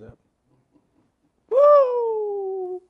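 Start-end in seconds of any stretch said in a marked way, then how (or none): 1.5-2.8 a high, loud whoop of joy; joyful whoop. Retold or imitated. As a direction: let out a happy whoop like the son. Son's joyful cry again.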